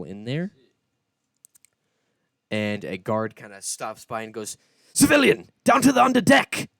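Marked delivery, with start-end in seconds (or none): 4.9-6.7 high, loud barked command; guard's shouted order. Acted. Guard ordering the last civilian below deck, shouting high. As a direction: order the straggler below, sharply.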